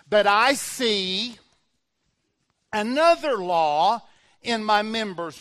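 loud, slow preacher's reading; emphatic scripture reading. Real, not acted. Preacher reading Romans to the congregation, loud and slow. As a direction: proclaim the verse, stretching key words. Reads scripture aloud with weight.

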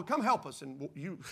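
usual speaking voice

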